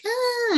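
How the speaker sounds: very high frightened squeak; scared yelp. Acted. Frightened journalist shrinking at the door, high yelp.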